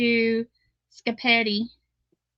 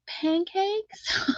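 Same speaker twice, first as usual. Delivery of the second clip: baffled question; 8 semitones higher, 4 dB quieter than usual. Retold or imitated. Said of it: "And I was like".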